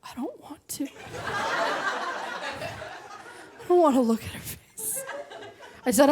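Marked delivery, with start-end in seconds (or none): 0.0-5.0 whispered, laughing plea of a broken dad; a hushed, defeated half-laughed plea; mock-broken father whispering; broken man's plea. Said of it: "I watched a broken man say".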